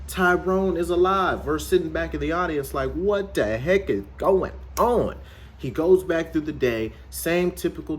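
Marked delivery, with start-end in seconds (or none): 2.9-5.2 high, slow exaggerated bewilderment; baffled outcry. Reviewer voicing the baffled audience, drawn-out outcry. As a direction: stretch it out in mock disbelief.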